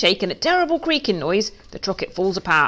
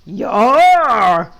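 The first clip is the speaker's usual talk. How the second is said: loud, high bleating yes; excited flock of sheep shouting yes; sheep chorus; 5 semitones higher, 9 dB louder than usual. Acted. Sheep eagerly agree to Duck's offer, loud bleat.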